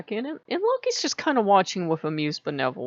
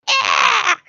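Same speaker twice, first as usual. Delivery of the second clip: high, loud Siamese yowl; much higher, louder cat scream; mock cat yowl; 8 dB louder than usual. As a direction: yowl right in their face like a Siamese. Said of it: "they're going".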